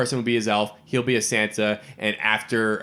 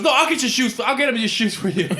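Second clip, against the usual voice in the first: a high, whining squabble voice; high whiny bickering; 9 semitones higher than usual. Retold or imitated. Wesley Snipes bickering with Woody Harrelson in the street, high whining voice. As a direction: whine it like a jealous sibling. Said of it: "And he goes".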